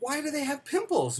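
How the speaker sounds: high-pitched imitation of a kid; curious child. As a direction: play the boy questioning his dad, pitched right up. Retelling child's follow-up question to dad.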